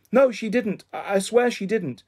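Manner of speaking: high, insistent protest; anxious denial. A narrator's reading. Child denies it under questioning.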